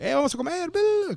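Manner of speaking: very high babbled bla bla; squeaky bla bla cheer. Retold or imitated. Calling coworkers to lunch with a squeaky cheer. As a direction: squeal it out playfully. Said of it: "Cada rato lo hacía".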